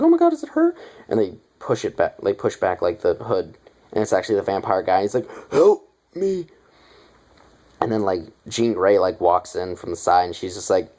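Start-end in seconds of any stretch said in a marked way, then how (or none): 0.0-0.7 high, loud shriek; panicked scream. Retold or imitated. Kids screaming at party. 5.5-6.5 high, loud yelp; mock cry for help. Vampire cries out comically.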